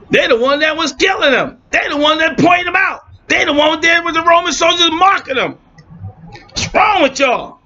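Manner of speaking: high, fired-up preaching voice; heated preaching. Real, not acted. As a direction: preach it hard, voice climbing. Heated preaching rant rising.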